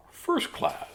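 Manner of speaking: loud, punchy read-aloud narration; theatrical storybook delivery; dramatic story reading. Acted. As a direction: tell the rhyming tale with big emphasis. Reads picture book aloud.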